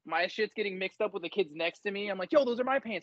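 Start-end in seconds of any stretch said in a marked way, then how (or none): 2.3-3.0 higher, indignant; indignant claim. Retold or imitated. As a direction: snap it back at the other recruit. Quarrel over pants.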